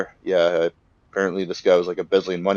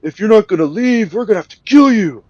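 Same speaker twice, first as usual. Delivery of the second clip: high, loud panicked yelling; shouting kidnapper; 13 semitones higher, 11 dB louder than usual. Retold or imitated. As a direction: bark the threat like a cornered crook. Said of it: "He's like".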